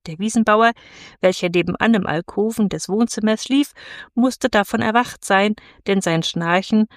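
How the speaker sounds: usual speaking voice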